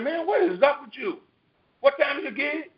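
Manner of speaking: high-pitched retold confrontation; exasperated. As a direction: take a sleepy friend to task, pitched well up. Retold exasperated confrontation with late friend.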